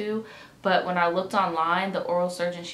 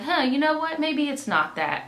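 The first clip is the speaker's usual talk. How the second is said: higher-pitched, airy second thought; mock casual reconsidering; 8 semitones higher, 3 dB louder than usual. Patient imagining she had let it go, mock casual reconsidering. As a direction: play at shrugging it off. Imagining herself brushing off the problem.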